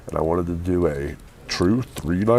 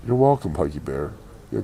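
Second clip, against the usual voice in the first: ordinary talk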